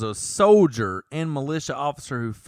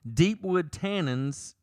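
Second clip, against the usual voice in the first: ordinary delivery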